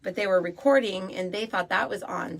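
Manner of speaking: normal talking voice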